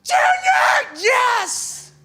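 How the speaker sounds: high, loud, thrilled shouting; ecstatic kid. Retold or imitated. Little boy offered to meet his hero, ecstatic shout. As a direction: explode with joy like a little boy.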